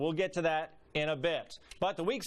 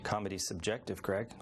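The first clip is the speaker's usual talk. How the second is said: smug deadpan; 10 semitones lower, 4 dB quieter than usual. Acted. Taunting the trapped Greg.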